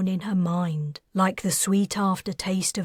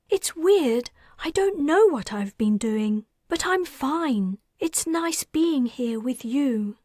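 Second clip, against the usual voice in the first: put-on lightly higher girl's voice, content; a happy little girl, pitched up; young girl Mickey; 7 semitones higher than usual. A narrator's reading.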